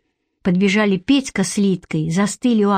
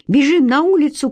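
rally the others to run outside; shout; 6 semitones higher than usual. A narrator's reading. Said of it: "крикнул я".

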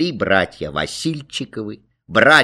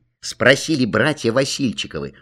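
usual speaking voice

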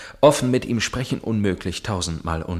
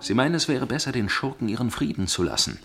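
ordinary talk, nothing marked

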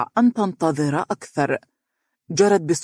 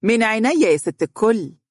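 happy reply; 6 semitones higher than usual. A narrator's reading. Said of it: "ضحكت أم يحيى بسعادة".

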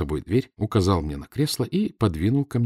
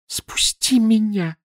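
high, slow pleading cry; жалобная просьба; 13 semitones higher, much slower than usual. A narrator's reading. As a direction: beg to be let down. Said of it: "просила она жалобно". (жалобно, со страхом)

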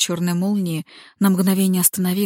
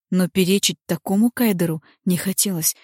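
plain reading voice

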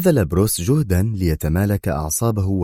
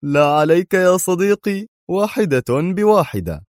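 cheerful friend; 11 semitones higher than usual. A narrator's reading. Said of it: "يربت على كتف أدهم في مرح قائلا".

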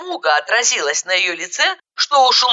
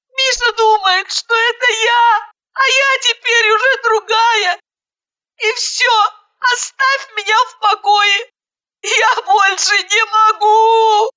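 high, tearful pleading; sobbing; 13 semitones higher, 4 dB louder, slower than usual. A narrator's reading. Sobbing bride begging her friend to leave her alone. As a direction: plead high through sobs, slowing at the end. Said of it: "И она вновь зарыдала".

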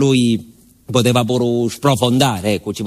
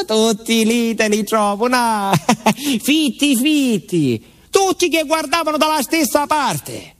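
high, half-laughing astonishment; laughing wonder at the crowd; excited disbelief; 10 semitones higher than usual. Acted. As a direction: tell it as if you still can't believe it.

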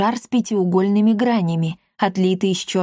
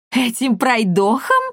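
indignant, slow, higher character voice; flaring up; 4 semitones higher than usual. A narrator's reading. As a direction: flare up in outraged disbelief. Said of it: "взвилась она". (возмущённо взвиться)

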